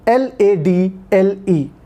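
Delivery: letters spelled out one by one, slowly; slow letter-by-letter spelling. Shown as a demonstration. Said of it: "spelling the word".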